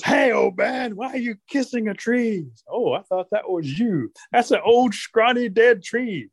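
high, lively teasing voices; bantering old couple. Acted. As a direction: toss it back in mock indignation. Grandma and old man trading teases.

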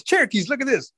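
high, urgent rabbit call; excited high-pitched summons. Acted. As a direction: call it high to get their attention. Rabbit calls out to Cherokees.

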